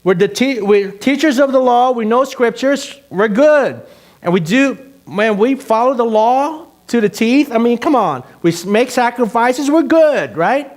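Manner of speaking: high, loud, cocky boasting; mock the self-satisfied teachers of the law; smug religious people. Preacher mocking proud religious leaders, smug loud boast. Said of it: "Their attitude was like".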